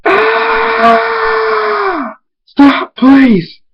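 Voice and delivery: long high scream into loud pleading; scream, then shout a high-pitched plea. A narrator's reading. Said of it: "Big Mac screams".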